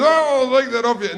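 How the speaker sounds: high, falsely breezy dad; dad quickly confiscating; mock casual dad; nervous dad. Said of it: "Dad goes".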